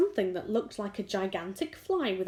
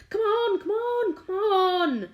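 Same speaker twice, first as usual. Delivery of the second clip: high, loud repeated yelling; impatient shout; 12 semitones higher, 7 dB louder, slower than usual. A narrator's reading. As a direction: hurry her up at full volume. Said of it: "shouted the centipede".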